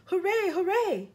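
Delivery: high, drawn-out cheer. A narrator's reading. Characters cheer at sighting land.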